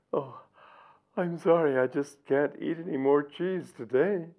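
ordinary talk

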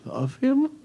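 high, incredulous squeak on love him; disbelieving, pitch shooting up; father stunned that his daughter loves the knight; astonished. Acted.

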